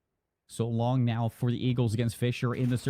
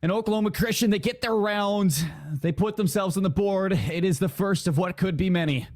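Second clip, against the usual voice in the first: raised-pitch esports shoutcast; live play-by-play; 9 semitones higher, 4 dB louder than usual. Real, not acted. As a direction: call the round win with lift.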